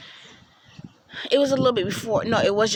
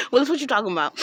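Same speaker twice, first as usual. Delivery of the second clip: catchphrase impression; 4 semitones higher, much faster than usual. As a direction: bark "Willis, what you talking about?" like the TV line. Famous TV catchphrase quoted.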